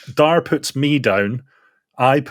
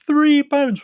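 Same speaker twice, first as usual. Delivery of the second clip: very high squeaky three pounds; high squeak. Shown as a demonstration. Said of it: "When I say it, it makes me go".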